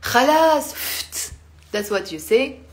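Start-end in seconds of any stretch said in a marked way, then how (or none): 0.0-0.7 loud high annoyed snap; annoyed enough. Shown as a demonstration. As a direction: cut them off, fed up. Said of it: "when you're annoyed".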